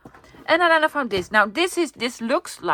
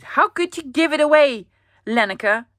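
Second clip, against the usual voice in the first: playful mock telling-off, louder and higher; a mock scolding of Lenneke for giving away her mom's gift; 5 semitones higher, 7 dB louder than usual. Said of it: "I'm angry with, at Lenneke".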